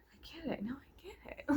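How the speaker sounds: high, sarcastic whisper. Real, not acted. Vlogger sarcastically whispering about the show.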